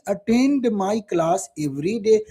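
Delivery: very slow, word by word for notes; slow dictating teacher; slow dictation. Shown as a demonstration. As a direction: spell it out, then say it slowly. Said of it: "English जल्दी से बता दीजिए".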